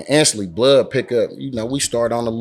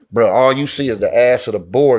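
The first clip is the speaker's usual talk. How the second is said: usual speaking voice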